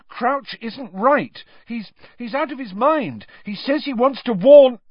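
angry pupil arguing with a teacher; angry urging. Acted. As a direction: press the point furiously. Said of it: "said Harry angrily".

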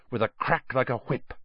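usual speaking voice

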